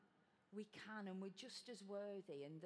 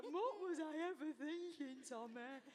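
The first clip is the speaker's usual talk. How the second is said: higher, louder, slow tearful cry; retold tearful outburst; 7 semitones higher, 7 dB louder, slower than usual. Actress on the stairs crying to the stage door guy, tearful wail. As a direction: replay the backstage panic through tears. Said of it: "And I burst into tears and went".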